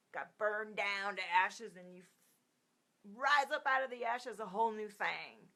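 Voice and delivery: loud, slow and dramatic; dramatic reading. Acted. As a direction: proclaim it like a rebirth. Describing resurrection card dramatically.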